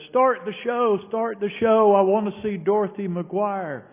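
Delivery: high, loud demanding shout; loud yell. Retold or imitated. Heckler in the theatre demands the show start, loud yell. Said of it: "she yells in a loud voice".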